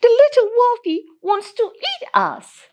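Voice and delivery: Grandma warning her granddaughter the wolf wants to eat them, in a high alarmed voice. A narrator's reading. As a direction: squeak it out like a frightened grandma. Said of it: "replied Grandma".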